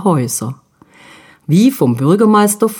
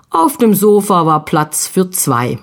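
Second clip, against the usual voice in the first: plain reading voice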